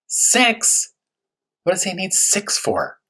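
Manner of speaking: high incredulous question; mock disbelief. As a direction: play baffled at the number. Baffled by six magnifiers.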